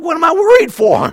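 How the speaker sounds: imitating his own nervous self at the IRS letter; mock worried. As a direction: squeal it at yourself, half laughing. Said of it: "I'm like".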